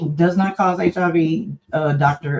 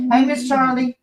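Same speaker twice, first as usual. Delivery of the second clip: high, slow coaxing pet voice; sing-song high coaxing; 8 semitones higher than usual. Real, not acted. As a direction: coo at the dog to say hey.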